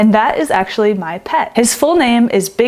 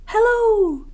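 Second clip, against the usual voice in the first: sleepy voice; 13 semitones higher than usual. Acted. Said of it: "called back a sleepy voice".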